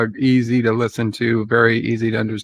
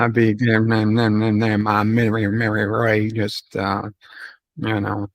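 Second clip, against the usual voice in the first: mumbled, run-on Southern gibberish; Boomhauer. Acted. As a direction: slur the words into one monotone ramble.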